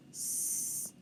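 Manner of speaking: "s" as a held voiceless hiss; sounding out. Shown as a demonstration. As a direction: isolate the sound "s" with no vowel. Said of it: "Let's sound that out".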